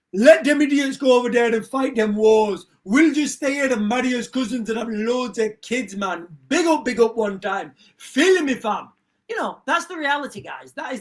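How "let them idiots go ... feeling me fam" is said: mock London street slang. Mocking other cultures' speech.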